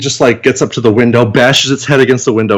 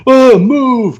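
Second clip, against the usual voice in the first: urgent shout; 13 semitones higher, 3 dB louder than usual. Retold or imitated. Girl bursts in with shotgun.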